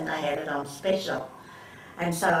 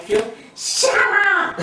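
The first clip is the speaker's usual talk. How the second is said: much higher and louder through laughter; laughing shout; 13 semitones higher, 7 dB louder, slower than usual. Retold or imitated. Dad telling his wife to hush, laughing loudly. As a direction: blurt it out, cracking up.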